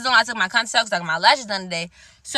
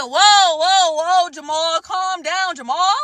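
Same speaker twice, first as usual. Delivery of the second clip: mock calming down; 5 semitones higher, 3 dB louder, much slower than usual. Mocking the stylist's outburst.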